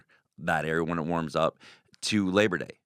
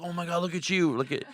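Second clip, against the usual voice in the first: high-pitched imitated amazement; mock freak-out at a sudden discovery; 9 semitones higher, faster than usual. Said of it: "they start freaking out".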